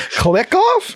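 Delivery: laughing through the question. Real, not acted. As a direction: giggle while asking it back. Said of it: "do you guys want me to click off".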